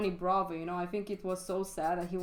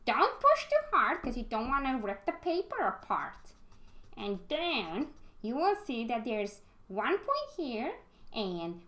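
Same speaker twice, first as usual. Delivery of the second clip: silly put-on voice. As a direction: try out a goofy tutor voice while drawing. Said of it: "Can I speak like that?".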